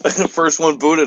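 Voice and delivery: usual speaking voice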